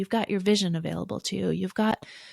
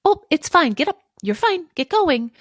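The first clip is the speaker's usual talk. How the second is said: much higher, louder, brisk it's-fine; dismissive brush-off; 8 semitones higher, 7 dB louder than usual. Shown as a demonstration. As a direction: wave the hurt away impatiently. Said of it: "As opposed to".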